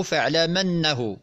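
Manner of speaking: فاعلمنه drawn out slowly with the meem opened by a fatha. Shown as a demonstration. Teacher slowly demonstrates the open-meem reading of "فاعلمنه". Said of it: "بفتح الميم".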